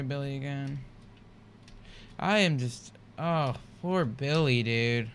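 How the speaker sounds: ordinary talk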